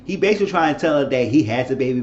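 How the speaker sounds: ordinary delivery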